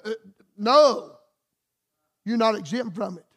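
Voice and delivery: normal talking voice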